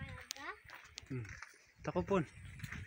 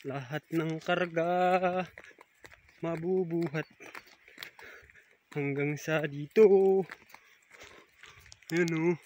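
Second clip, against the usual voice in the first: ordinary talk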